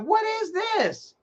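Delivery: high, slow, baffled what is this; mock shocked gasp of a question; incredulous. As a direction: stretch it out in disbelief. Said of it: "I'm like".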